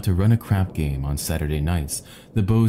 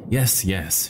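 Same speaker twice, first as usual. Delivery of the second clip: ordinary delivery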